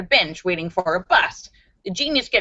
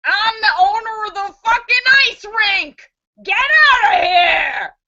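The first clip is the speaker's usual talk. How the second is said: angry rink owner; 12 semitones higher, 4 dB louder than usual. Acted. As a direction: chase him off the ice furiously.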